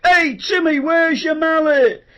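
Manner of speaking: loud, high mocking call; people in the street. Strangers shouting at the presenter in the street, loud and high. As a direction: hail him across the street. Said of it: "people still stop me in the street".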